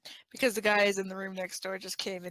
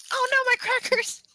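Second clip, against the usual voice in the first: high, loud yelp of dismay; dismayed cry; 5 dB louder than usual. Real, not acted. Cat knocks over crackers, a high dismayed yelp. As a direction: wail over spilled crackers.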